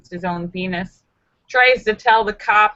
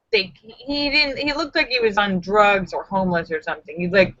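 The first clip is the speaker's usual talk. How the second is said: ordinary delivery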